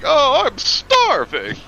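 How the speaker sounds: very high, loud, slow wail; whiny starving. Retold or imitated. Mocking Sokka's whining line, high drawn-out wail. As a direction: wail it like Sokka begging for food. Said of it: "Just kept saying".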